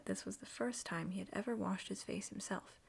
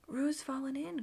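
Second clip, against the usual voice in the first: a raised, higher alarmed call; alarmed cry; 6 semitones higher, 5 dB louder, slower than usual. A narrator's reading. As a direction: cry out that Roo's in the water. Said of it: "cried Rabbit".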